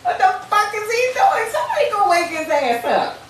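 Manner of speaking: high, loud, panicky shouting with a laugh breaking in; panicked disciples. Retold or imitated. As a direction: yell it like sailors sure they are about to drown. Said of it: "the disciples are scared as a motherfucker".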